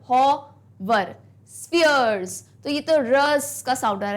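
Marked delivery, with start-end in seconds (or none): 0.1-2.4 hover stretched slowly, then spheres; slow sounding out. Shown as a demonstration. Teacher sounds out the end words for the class. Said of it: "एक बार बोल के देखो पिछला word".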